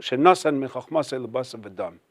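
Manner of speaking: "shenosan mechachmasei lebasav adam" mumbled softly, barely audible; mumbled blessing. Retold or imitated. Schwartz greets the doctor with the blessing, softly mumbled. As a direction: mutter "shenosan mechachmasei lebasav adam" under your breath. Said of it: "he mumbles it. He doesn't say it loudly. It's barely audible".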